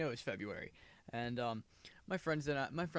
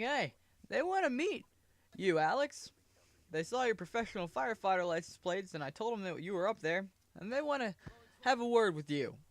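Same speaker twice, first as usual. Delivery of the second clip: lightly higher friend voice; friend on phone; 6 semitones higher than usual. Retold or imitated. Friend calling from downstairs, lightly voiced and eager. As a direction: relay the news a bit eagerly.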